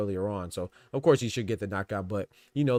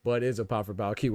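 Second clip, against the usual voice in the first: ordinary talk, nothing marked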